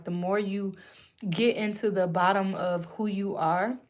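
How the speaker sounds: ordinary delivery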